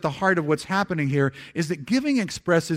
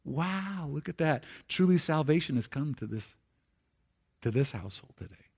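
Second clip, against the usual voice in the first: much softer, hushed wonder; quiet awe at a changed heart; marveling; 8 dB quieter than usual. Acted. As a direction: breathe it out in amazement. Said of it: "And Jesus is marveled".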